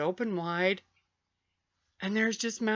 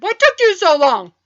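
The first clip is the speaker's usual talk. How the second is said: higher, much louder annoyed demand; impatient mother; 10 semitones higher, 13 dB louder than usual. Retold or imitated. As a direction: snap at the kid who took forever. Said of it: "No, she said".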